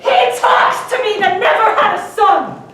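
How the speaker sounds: loud, bitter retort; bitter outburst. Acted. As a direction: snap back in fury. Retort to the cardinal.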